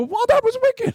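very high-pitched comic yell; mock startled shriek. Speaker reacting to the startled sleeper, mock shriek.

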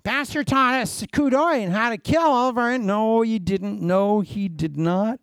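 high, put-on protesting voice; hammed-up high-pitched back-and-forth; mock misquoting and denial. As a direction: argue it in a high comic voice, easing down. Acts out listeners misreporting and objecting.

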